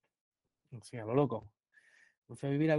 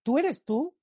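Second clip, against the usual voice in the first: put-on much higher, gushing older lady voice; excited lady; 9 semitones higher, 4 dB louder, slower than usual. Lady in the metro recognising him, high gushing voice. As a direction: squeal it like a delighted fan spotting him. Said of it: "una señora de frente me dice".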